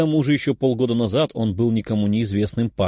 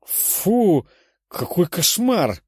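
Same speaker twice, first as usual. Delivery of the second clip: high, drawn-out disgust; appalled and grossed out; horrified; 8 semitones higher, much slower than usual. A narrator's reading. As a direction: recoil with a slow, high-pitched "ugh". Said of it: "ужаснулась длинноухая Златовласка".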